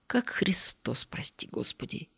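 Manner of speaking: slow, soft whisper; whispered aside. Acted. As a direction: breathe it to yourself. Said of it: "И уже в голос".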